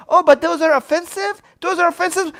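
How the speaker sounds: high-pitched mimicry of complainers; mocking objection. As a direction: throw their complaint back, high and incredulous. Mimics objectors mid-rant.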